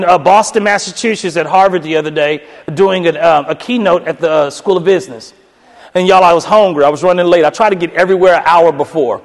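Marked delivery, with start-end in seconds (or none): none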